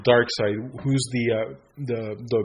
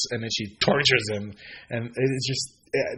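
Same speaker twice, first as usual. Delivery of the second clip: ordinary talk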